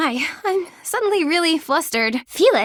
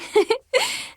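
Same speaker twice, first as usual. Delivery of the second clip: happy giggle; 10 semitones higher than usual. Acted. Happy giggle after thanks.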